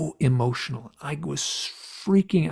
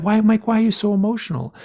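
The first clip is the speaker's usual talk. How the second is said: higher, louder, fast concerned question; higher imitation of Yvonne asking; mimicking Yvonne; 8 semitones higher, 6 dB louder than usual. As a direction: voice the hypnotist's worried question. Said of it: "Yvonne is like".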